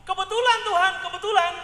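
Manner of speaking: thrilled at the lucky sign; excited. Retold or imitated. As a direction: kebetulan called out with glee. Excited realisation, looking for a wife.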